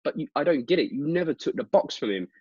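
mock bafflement, voice pushed high; confused mate. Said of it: "when the guy goes".

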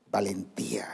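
a hushed, whispered single word; preacher whispering the lesson word after David's bold line. Real, not acted. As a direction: breathe it out as a secret.

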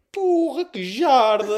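hammed-up shout of shock; loud exclamation. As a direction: yell it out leaving the room. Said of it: "Disse assim".

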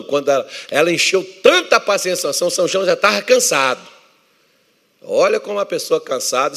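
loud, high, driving pulpit voice; forceful preaching. Acted. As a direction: preach it out to the church. Preaching Samson story forcefully.

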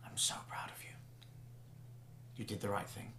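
soft, proud whisper. Acted. Mother hugging her son, whispering softly. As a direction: breathe it close into his ear. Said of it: "and whispers to you".

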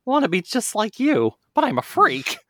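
put-on high, small child voice; Miles voice. The little fox confessing to his hero, high childlike voice. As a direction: plead like a sad little kid. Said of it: "child fox speaking to Sonic".

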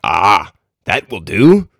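slow, drawn-out exclamation of approval; crying out in approval. A narrator's reading. Satan approving the imp's plan, slowly exclaiming. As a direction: savour it slowly as the answer sought. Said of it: "cried Satan".

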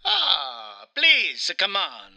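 offended Italian, hammed up; Mario with Italian accent. Mario objects to the game's voice, in an indignant Italian accent. Plays Mario being interviewed.

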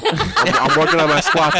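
slow, laughing high comeback. Real, not acted. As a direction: laugh it out, stretched.

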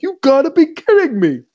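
high, loud incredulous coach quote; hammed-up old coach disbelief; mock Lou Holtz. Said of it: "he just was like".

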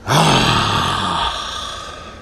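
long, heavy exhale; exasperated sigh. Real, not acted. Wrestling podcaster fed up after ranting about the Miz, exasperated sigh. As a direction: let out a long, weary sigh.

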